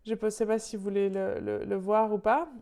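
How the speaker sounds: ordinary delivery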